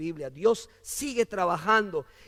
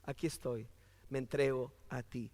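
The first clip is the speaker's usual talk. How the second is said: very soft, low prayer; hushed surrender to God; 9 semitones lower, 15 dB quieter than usual. Acted. Preacher models answering God's call, hushed surrender. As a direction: murmur it low, as a private prayer. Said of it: "responder a ese llamado y decirle".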